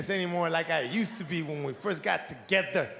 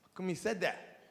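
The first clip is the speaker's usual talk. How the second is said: soft coaxing dad; 4 semitones lower, 7 dB quieter than usual. Retold or imitated. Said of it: "I gotta go up to him like".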